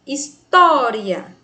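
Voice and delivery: "história" slow and loud on a long "tó"; stress on penultimate syllable. Shown as a demonstration. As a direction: punch and stretch "tó" in "história". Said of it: "Sílaba tônica, a penúltima". ("história" devagar, com "tó" forte e alongado)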